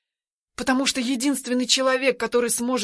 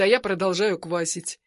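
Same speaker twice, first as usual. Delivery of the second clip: plain reading voice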